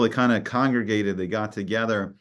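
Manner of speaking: ordinary talk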